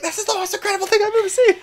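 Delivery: high, fast mock fanboy rapture; imitated ecstatic geek. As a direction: gush like an overexcited fan. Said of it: "He's like".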